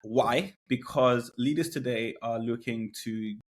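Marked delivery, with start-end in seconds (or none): none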